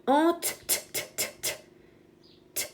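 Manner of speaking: high "En", then whispered "t, t, t" sounds; sounding out letters. Shown as a demonstration. Teacher sounding out the T for pupils, whispered phonics. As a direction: sound out "t" as a breathy, voiceless tap, over and over. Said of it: "Le T".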